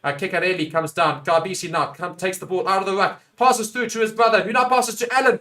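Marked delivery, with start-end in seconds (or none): 3.4-5.4 higher, building live commentary; keen rugby match call; excited play-by-play. Real, not acted. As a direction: build anticipation with each pass.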